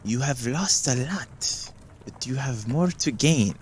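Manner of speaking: very slow, measured seer voice; slow portentous palm reading; slow mystic prophecy. Acted. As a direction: intone it like a fortune. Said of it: "And she says".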